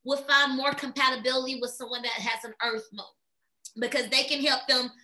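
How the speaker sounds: ordinary talk, nothing marked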